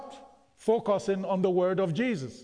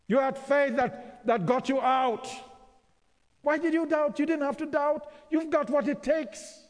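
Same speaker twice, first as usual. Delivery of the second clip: full-force preaching; 7 semitones higher than usual. Acted. Preacher pressing the congregation, rising to impassioned preaching. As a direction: build the sermon to a ringing peak.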